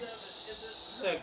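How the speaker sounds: very slow drawn-out. Acted. Drawn-out emphatic echo.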